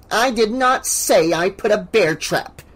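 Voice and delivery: high, flustered protest; stammering denial. A narrator's reading. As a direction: protest indignantly, caught out. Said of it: "Missus Deagle stammered".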